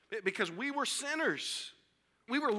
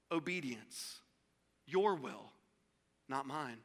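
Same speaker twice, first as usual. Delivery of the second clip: low, hushed surrender; surrendering prayer; 7 semitones lower, 7 dB quieter, slower than usual. Acted. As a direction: yield softly, dropping low with pauses.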